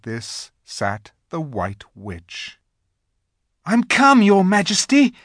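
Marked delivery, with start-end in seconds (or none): 3.6-5.1 put-on high, loud eager boy. A narrator's reading. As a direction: rush up to the queen. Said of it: "rushing eagerly forward".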